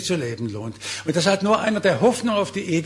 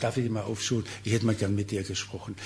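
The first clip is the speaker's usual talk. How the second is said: put-on softly asking a young person, quieter; gentle invitation; 5 semitones lower, 6 dB quieter than usual. Preacher acting out an invitation to a young person, soft and kind. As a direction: lean in and ask kindly.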